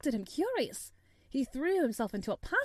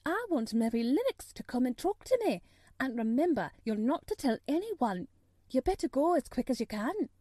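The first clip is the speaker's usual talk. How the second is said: higher-pitched maid relaying urgent orders; pitched-up servant girl's character voice; Martha the maid; 3 semitones higher, 3 dB louder than usual. Acted. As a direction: report it in a lifted, lively maid's voice.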